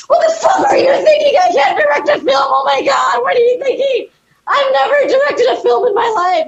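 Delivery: put-on high, loud drunken rant; mock yelling at husband. Wife yells at husband after whiskey, loud rant. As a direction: scold him at full volume.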